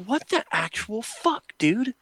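high, slow, disbelieving squeal; mock-shocked high-pitched cry; incredulous outrage. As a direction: act out being totally appalled. Outraged at upstairs neighbours.

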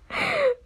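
short, very high giggle; real laugh. Real, not acted. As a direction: giggle before signing off. Laughs before signing off.

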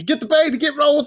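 quick high-pitched commands; acted urgent call, pitched well up; mock urgent orders. Captors ordering each other to grab the baby, mock urgent. Voicing the men seizing the baby.

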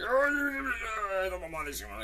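high-pitched hammed-up yelp of pain; mock whiny gym-goer. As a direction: whimper it up high like a strained lifter.

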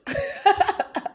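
high embarrassed laugh; real giddy laughter; giggle burst. Real, not acted. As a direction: crack up at your own confession. Embarrassed at intimate detail.

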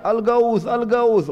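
high mock cry for help; imitated continued shouting. Damdam keeps shouting as people listen, mock cry. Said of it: "dia terus teriak".

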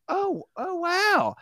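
very high awed gasp; awe. Retold or imitated. Narrator amazed at the house, high and awed. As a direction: breathe it in wonder.

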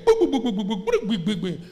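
rapid booms, loud then easing off; imitated bomb blasts. Preacher tells of a birthday bombing, imitating the blasts. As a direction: mouth a string of explosions tailing down. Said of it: "there was another bomb again".

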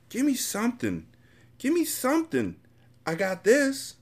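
very high, whiny desperate plea; begging addict. A narrator's reading. Addict mother begging through the door slot, high whine. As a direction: beg in a thin, high whine.

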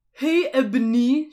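drawn-out shout of a name; calling out. Acted. A voice calls to Ebony outside Hogwarts, shouting. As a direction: call out loudly, stretching it. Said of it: "shouted a voice".

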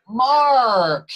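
"Mark" loud, high and drawn out with a hard r; exaggerated American pronunciation. Traveler making Americans understand his name, "Mark" in exaggerated American. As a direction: stretch "Mark" into a big American drawl. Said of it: "To get them to actually understand what my name is".

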